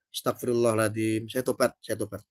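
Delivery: low, soft penitent murmur; mock repentance. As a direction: repent quietly, half-hearted. (bertobat pelan setengah bercanda)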